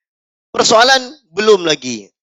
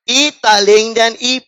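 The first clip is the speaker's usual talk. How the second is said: "E taling dan E" broken up by giggles; a repeat of "E taling dan E" lost in laughter; laughing through it. Shown as a demonstration. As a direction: try to say "E taling dan E" but crack up laughing. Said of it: "Sekali lagi".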